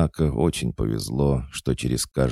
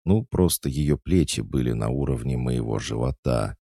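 ordinary delivery

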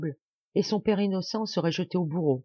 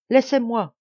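loud, higher-pitched protest; struggling cry; 7 semitones higher, 7 dB louder than usual. A narrator's reading. Maurice struggling against those carrying him off, crying out. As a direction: cry out to be released. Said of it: "criait-il en se débattant".

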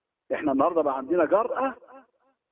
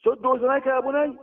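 now insist, raise the voice on بني; father urging his son a second time; urgent repeated warning; 7 semitones higher than usual. Retold or imitated. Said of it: "قال لابنه جنبه".

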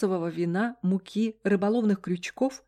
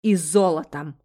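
low, louder menacing hiss; 3 semitones lower, 6 dB louder than usual. A narrator's reading. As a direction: threaten her with the word. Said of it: "грозно зашипел продавец".

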